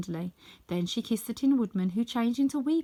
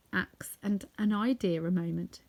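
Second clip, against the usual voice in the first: ordinary delivery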